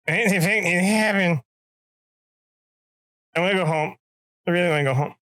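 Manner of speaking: high pitiful whine; whiny captive. Retold or imitated. Captured recruit in the bush whines to himself, high and pitiful. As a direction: plead like a scared recruit who wants home.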